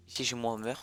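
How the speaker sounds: normal talking voice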